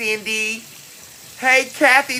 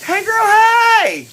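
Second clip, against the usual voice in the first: excited sing-song greeting; 13 semitones higher, 6 dB louder, slower than usual. Real, not acted. Greeting viewers joining her live, high excited sing-song. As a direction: call it out big like a catchphrase.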